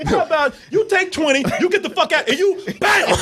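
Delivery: high laughing mock threat; giggling high mock gangster; laughing villain. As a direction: laugh through the villain's deal. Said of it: "You know what?".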